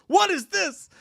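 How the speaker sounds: high-pitched mock indignation; jokingly appalled, very high; mock outrage. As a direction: act scandalized, pitch leaping way up. Mock outrage at girlfriend's disloyalty.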